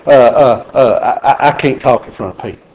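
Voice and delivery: nervous stammer. Retold or imitated. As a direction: stammer like someone scared to speak in public. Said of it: "they say".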